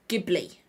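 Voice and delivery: "Ghibli" pronounced GIB-lee. Shown as a demonstration. Vlogger offering a third guess, Ghibli as hard-g GIB-lee. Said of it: "I've never heard it pronounced".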